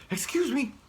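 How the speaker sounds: high, hurried squirrel voice; a squirrel excusing himself as he flees. Acted.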